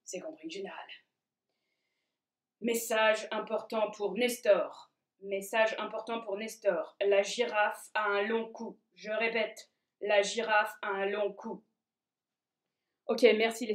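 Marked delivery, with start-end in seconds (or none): 0.0-0.9 low secret agent. Acted. Secret service answers. 2.6-11.6 low, slow secret agent; slow, low coded message read. Acted.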